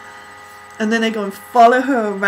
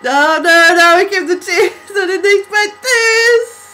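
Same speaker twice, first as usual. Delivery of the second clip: loud, tearful overwhelmed wail; overwhelmed crying; 7 semitones higher, 10 dB louder than usual. Real, not acted. Fan reacting to a music video, loud tearful crying. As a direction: cry out, overcome by the video.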